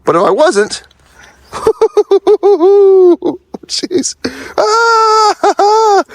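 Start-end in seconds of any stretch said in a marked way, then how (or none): 1.5-3.6 big burst of laughter; loud helpless laugh. Real, not acted. Laughing at own fall. 4.6-6.0 sharp high yelp; startled cry. Real, not acted. Sudden slip on ice.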